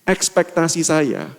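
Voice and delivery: normal talking voice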